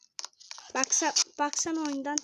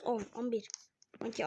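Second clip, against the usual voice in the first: ordinary talk, nothing marked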